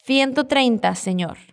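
Castilian accent, "Ciento" with a th, dropping on "señor"; Spanish accent. Shown as a demonstration. Policewoman's answer repeated for learners, Spanish accent. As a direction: model a Spain accent for learners. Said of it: "usando el acento que estamos practicando".

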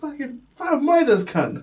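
1 mock angry bouncer.

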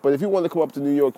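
usual speaking voice

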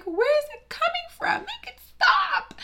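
high, loud, squealing panic; comic distress, shrieking for it to stop; mock panic. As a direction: wail it like the colour is attacking you. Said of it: "I'm like".

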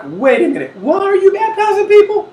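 high, loud mock disbelief; hammed-up, laughing outrage at the very idea; incredulous challenge. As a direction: protest in comic bafflement with laughter around it. Questioners acted out in disbelief.

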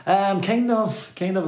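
high, slow, drawn-out hesitant kind of; sheepish hedge. Retold or imitated. Answering Bonnie's friend, sheepish and drawn out. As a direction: stretch it out like you're dodging the question.

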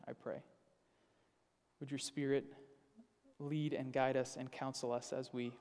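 soft, hushed, unhurried prayer voice; quiet prayer. Acted. Pastor praying softly before the sermon, hushed and reverent. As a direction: pray gently, as if before the congregation.

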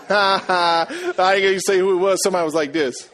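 talking through laughter; laughing. Real, not acted. Preacher teasing the congregation about loose tongues, laughing. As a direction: let the laugh spill into the words.

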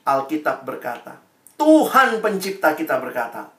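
ordinary talk, nothing marked

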